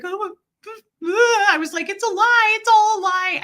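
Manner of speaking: high, loud crying outburst; bawling. Retold or imitated. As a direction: cry it out as the truth breaks. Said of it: "and I was bawling".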